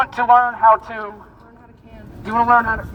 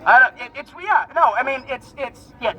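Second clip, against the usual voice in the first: ordinary delivery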